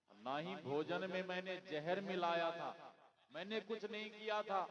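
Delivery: high, loud acted denial; storyteller's indignant accused man; protesting innocence. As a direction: plead innocence, pitch climbing.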